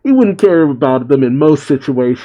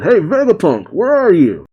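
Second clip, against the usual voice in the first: higher-pitched mock shout, no real volume; calling out; 5 semitones higher than usual. A narrator's reading. Frankie calls out for Vegapunk, mild mock shout. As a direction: call out for someone, pitch lifted. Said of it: "He then shouts out".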